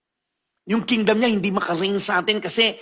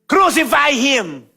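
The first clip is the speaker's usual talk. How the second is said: slow, loud and high demand; crowd shouting; 6 semitones higher, 5 dB louder, slower than usual. Retold or imitated. Pastor voices the turned crowd, slow loud demand. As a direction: call for his death like an angry mob. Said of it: "what they're shouting".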